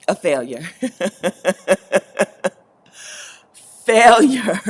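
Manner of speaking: loud laughing cry of failure. Real, not acted. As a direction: burst out laughing on the word.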